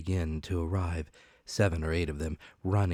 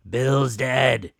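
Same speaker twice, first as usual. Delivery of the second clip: loud raised pirate call; shouting from the house; 6 semitones higher, 10 dB louder than usual. Acted. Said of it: "a voice shouting from the house".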